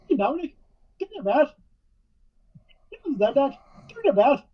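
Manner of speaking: put-on high-pitched Irish accent; Finn Balor Irish accent. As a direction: call him out in an Irish accent. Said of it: "a fucking Irish accent again".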